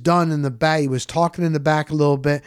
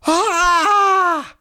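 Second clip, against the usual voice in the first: high mock yell of joy; imitating a fan's excited yell; 3 dB louder than usual. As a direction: let out a thrilled Australian yell. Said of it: "he let out a yell".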